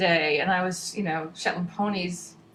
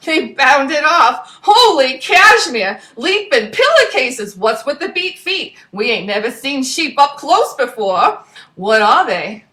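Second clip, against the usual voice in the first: louder, brisk storyteller voice; lively story narration; 5 semitones higher, 10 dB louder than usual. A narrator's reading. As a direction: tell the adventure with gusto.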